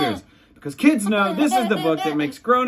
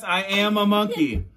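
ordinary delivery, nothing marked

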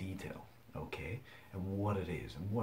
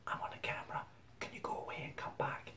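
whispered plea; 10 semitones higher, 6 dB quieter than usual. Retold or imitated. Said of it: "and I'll go".

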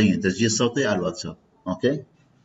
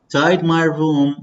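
slow and higher; slow model phrase; 5 semitones higher, much slower than usual. Shown as a demonstration. Teacher drilling vocabulary slowly for students. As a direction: stretch it out for learners. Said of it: "read with me".

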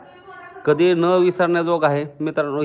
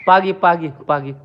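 ordinary delivery

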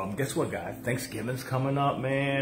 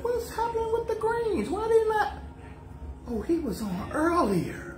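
much higher, squeaky voice for the viewers; high mock viewers. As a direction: play the confused viewers, way up high. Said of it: "they're gonna be like".

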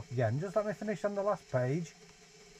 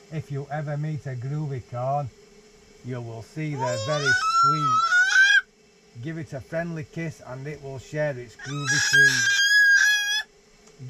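louder, slower read-aloud over the dog's noise; storybook reading; 7 dB louder than usual. Acted. Dad keeps reading the book to his dog over her singing, louder. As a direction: read it out big and slow.

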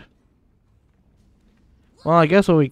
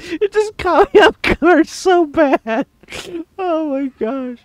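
high, louder words still laughing; 10 semitones higher, 4 dB louder than usual. Real, not acted. Gamer to viewers, still caught off guard, laughing. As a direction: speak through leftover laughter.

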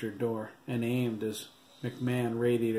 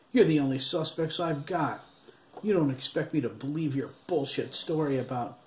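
raised, scornful retort; irritated snap; 4 semitones higher than usual. A narrator's reading. Cop snapping at suspects in the back seat, raised scorn. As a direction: shut the boys down hard. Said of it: "Shooter snapped".

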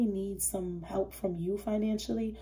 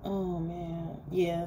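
usual speaking voice

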